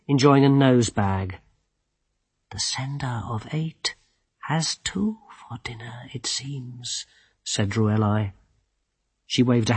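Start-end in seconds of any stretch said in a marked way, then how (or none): 2.5-7.1 breathy hushed whisper; whispering dryad. A narrator's reading. Druelai whispered voice.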